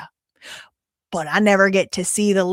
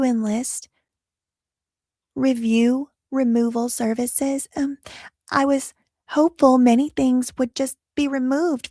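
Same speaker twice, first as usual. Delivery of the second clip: put-on marketing director voice, higher, starting hushed and airy; 3 semitones higher than usual. Host mocking marketing director's trust-rebuilding statement, put-on higher voice.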